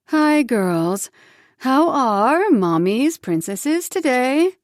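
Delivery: slow, sweet-talking mom; sugary mother. Acted. Mother greeting daughters, slow sugary coo. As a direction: coo it slowly at the girls.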